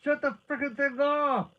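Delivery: urgent high-pitched shout; loud, high, frantic command; yelling. A narrator's reading. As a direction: yell the order across the room. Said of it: "he yelled".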